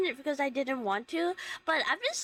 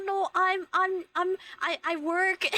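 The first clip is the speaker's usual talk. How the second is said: flustered, higher-pitched stammer; 4 semitones higher than usual. Retold or imitated. Flustered worker answering a girl's question, stammering. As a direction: trip over the first words, retelling your awkward reply. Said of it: "And I was stammering".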